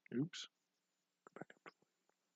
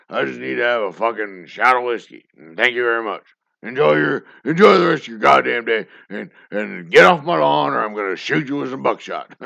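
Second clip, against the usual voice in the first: put-on loud ranting grumpy old man; 4 semitones higher, 13 dB louder than usual. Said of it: "It's an old [name] yelling at people".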